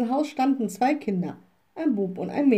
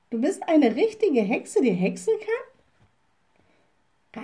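higher, astonished and slightly frightened; startled girl; 5 semitones higher, slower than usual. A narrator's reading. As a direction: ask it in startled disbelief. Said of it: "rief das Mädchen erschrocken". (erschrocken staunend, höher)

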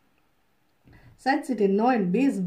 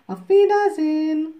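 louder, higher goodbye; little witch calls goodbye to the wood women; loud farewell; 6 semitones higher, 8 dB louder than usual. Acted. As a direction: call the goodbye after them. Little witch says goodbye back.

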